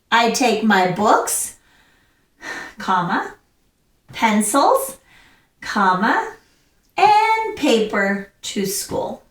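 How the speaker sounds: BOOKS and PENCILS each rise, then a breath and a spoken "comma"; pausing at each comma. Shown as a demonstration. Teacher correcting the run-on list for class, pausing at each comma. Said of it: "stop and you take".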